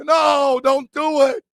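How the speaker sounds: high, loud mock plea; mock pleading shout. Begging his friend not to fall in love, mock shout. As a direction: yell at him not to do it, half joking. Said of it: "I go".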